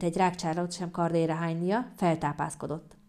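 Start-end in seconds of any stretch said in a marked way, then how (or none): none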